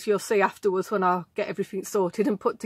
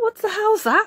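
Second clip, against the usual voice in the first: a high mock-puzzled what the hell; retold surprise; 10 semitones higher than usual. Finding old shoes while tidying, a high retold startle. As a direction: replay the jolt of finding them, lightly. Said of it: "I thought".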